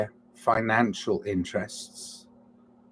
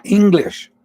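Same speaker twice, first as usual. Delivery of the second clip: sudden outburst; 9 semitones higher, 8 dB louder than usual. Real, not acted.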